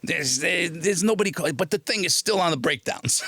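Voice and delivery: high, raspy put-on voice; scratchy agent voice. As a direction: imitate an exasperated agent with a scratchy voice. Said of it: "he had this really scratchy voice".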